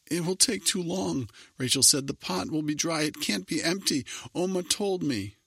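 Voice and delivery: high, desperate near tears; girl begs, about to burst into tears; panicked plea. A narrator's reading. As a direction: plead on the edge of crying.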